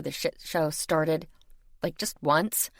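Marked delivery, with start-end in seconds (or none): none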